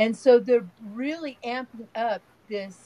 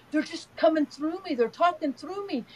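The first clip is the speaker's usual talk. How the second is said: high-pitched retold child's amazement; imitating grandson; 7 semitones higher than usual. Grandson telling Nana they speak through him, imitated amazement. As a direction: play a little boy reporting something astonishing. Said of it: "he was so funny because he goes".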